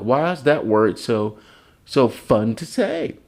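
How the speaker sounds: ordinary talk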